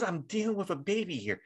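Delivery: lightly voiced wife scolding; exasperated wife. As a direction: needle him like a fed-up wife. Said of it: "she goes".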